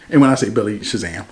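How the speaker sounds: ordinary talk, nothing marked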